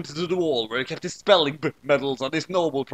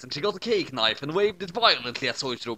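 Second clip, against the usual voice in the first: plain reading voice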